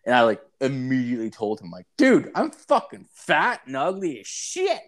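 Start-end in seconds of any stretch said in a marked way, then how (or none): 2.0-4.8 high, slow, flustered protest; mock flustered. Brushing off a hockey player's flirting, high and flustered. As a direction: squirm away from the compliment.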